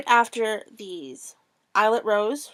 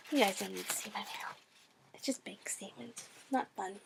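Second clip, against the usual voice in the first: whispering; 14 dB quieter than usual. Real, not acted. Aside hiding her mail.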